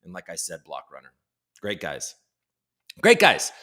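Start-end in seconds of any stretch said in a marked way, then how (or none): 1.6-2.1 low, soft aside; subdued. Real, not acted. Signing off a shout-out to the team, subdued. Says it low, then repeats it loudly. 3.0-3.5 high, loud burst of praise; hyped-up. Real, not acted. Praising the team to stream viewers, hyped-up. Repeats the praise with sudden energy.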